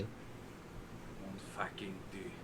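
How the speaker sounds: slow, low, drawn-out French curse; French exclamation. Pretend. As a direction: groan it out in French.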